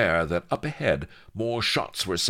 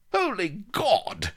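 high, drawn-out astonished cry; weak exclamation; slower than usual. Acted. Colonel Blood recognising his captor, astonished exclamation. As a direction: exclaim it slowly, aghast. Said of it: "exclaimed Colonel Blood weakly".

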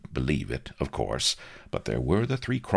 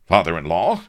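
loud, higher, rising echo; purple-faced disbelief; 4 semitones higher, 9 dB louder than usual. A narrator's reading. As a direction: repeat it as an outraged question. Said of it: "Rook went purple".

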